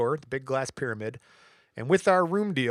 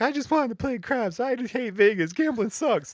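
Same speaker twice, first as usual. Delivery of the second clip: much higher mock sulk; hammed-up pouting complaint; upset whining; 10 semitones higher than usual. Wife sulking after losing, high mock whine. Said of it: "she's all upset".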